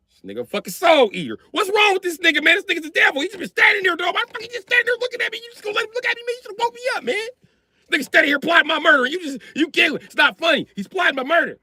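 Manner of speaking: high, fast panicked shouting; panicked rant. Retold or imitated. Dad freaking out to Mari about the staring son, high panicked shouting. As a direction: rant it high and frantic, scared of the kid.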